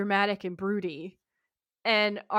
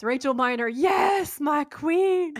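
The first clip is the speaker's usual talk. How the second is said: high, loud excited cheer; fangirl shout; 6 semitones higher, 5 dB louder, slower than usual. Real, not acted. Host cheering for the actress, fangirl shout. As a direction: yell it like a thrilled fan. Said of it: "my notes say in all caps".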